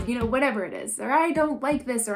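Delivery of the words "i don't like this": forceful yell. Shown as a demonstration. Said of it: "it would be like".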